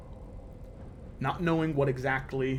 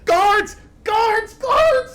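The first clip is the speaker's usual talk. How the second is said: much higher, loud shriek climbing each time; screaming for guards; 11 dB louder, much slower than usual. Acted. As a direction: yell for help in pain and panic. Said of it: "she sort of".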